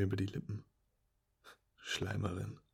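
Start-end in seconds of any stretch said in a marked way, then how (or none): none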